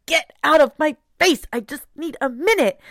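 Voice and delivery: loud, high-pitched exasperated snap; impatient yelling. Acted. Stressed parent to kids at home, impatient yelling. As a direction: act out a parent at the end of patience.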